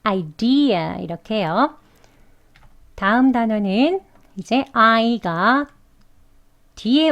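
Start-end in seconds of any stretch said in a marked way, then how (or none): 0.0-1.0 idea with the ee slowly stretched out; long ee. Shown as a demonstration. Teacher stretches the ee in idea, slow demo. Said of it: "길게 발음해 주시면 되겠습니다".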